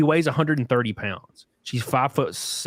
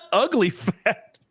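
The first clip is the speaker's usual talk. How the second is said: slow, high amused repeat; amused echo; 7 semitones higher, much slower than usual. Real, not acted. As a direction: draw out the words higher, tickled by the joke. Repeats a joke, amused after laughing.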